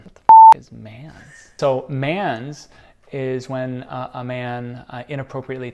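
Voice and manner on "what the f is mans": shocked outburst. Real, not acted. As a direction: blurt it out in disbelief. Outburst of disbelief.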